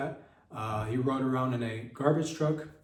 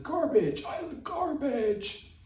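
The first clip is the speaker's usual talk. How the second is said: desperate yell; much slower than usual. Retold or imitated. Comedian chasing the garbage truck shrieks garbage. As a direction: shriek it like a madman. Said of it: "And I said".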